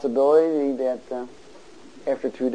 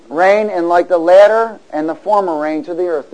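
loud, emphatic declaiming; forceful call-and-response reading; preacher's scripture reading; 4 semitones higher, 10 dB louder than usual. Real, not acted. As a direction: proclaim the verse, pausing between phrases for the congregation. Reads scripture to congregation.

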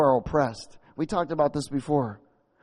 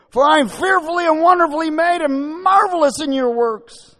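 loud, high fervent preaching; preaching at full force; 14 semitones higher, 13 dB louder than usual. Acted. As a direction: cry out the verse in praise.

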